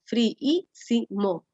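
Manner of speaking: friísimo drawn out slowly, each syllable apart, long double i; syllable-split demo. Shown as a demonstration. Teacher to students, slowly sounding out friísimo syllable by syllable. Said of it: "Ahí hay cuatro".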